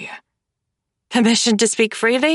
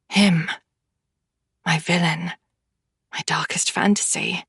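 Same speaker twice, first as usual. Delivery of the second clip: hushed whisper; 3 semitones lower than usual. A narrator's reading. Narrator dwelling on her villain.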